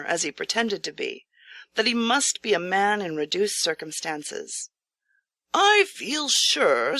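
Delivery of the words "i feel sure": priest. Acted. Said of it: "said the priest".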